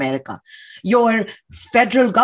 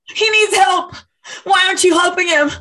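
high, insistent, angry plea; urgent protest; 8 semitones higher than usual. Acted. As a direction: demand help urgently, pitched high. Protester demands cop help man.

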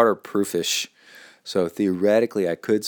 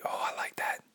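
whisper; 13 dB quieter than usual. Real, not acted. Aside reacting to email.

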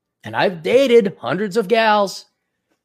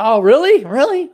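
mock disbelief; 8 semitones higher, 6 dB louder than usual. Mock incredulous reaction.

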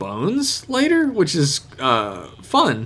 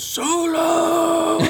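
high shouted cry; shouting. Retold or imitated. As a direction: belt the name out high. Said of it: "Bones goes".

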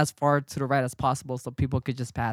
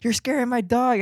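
higher-pitched retold yell; mock yelling; 8 semitones higher than usual. Dog owner yelling at the cyclist, in a mock raised voice. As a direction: imitate the stranger's raised-voice complaint. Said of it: "yelling random things at each other".

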